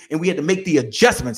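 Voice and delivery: ordinary talk, nothing marked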